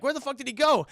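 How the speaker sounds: loud, high mock exasperation; baffled yell. Burglars finding the kid gone, baffled yell. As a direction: holler in comic bewilderment.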